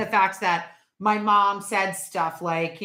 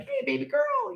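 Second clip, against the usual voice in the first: much higher teasing hello; viewers greeting; 3 dB quieter than usual. Retold or imitated. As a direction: mimic fans calling out sweetly. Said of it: "they're like".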